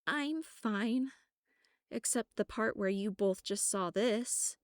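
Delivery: nervous, voice wavering; shaky voice. A narrator's reading. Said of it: "answering with a shaky voice".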